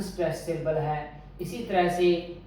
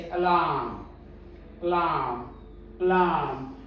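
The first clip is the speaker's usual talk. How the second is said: Alarm with a faint अ and long stressed लार्म; unstressed first syllable. Shown as a demonstration. Teacher models alarm, first syllable unstressed. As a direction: weaken the अ of alarm, lean on लार्म. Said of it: "unstressed symbol का part है".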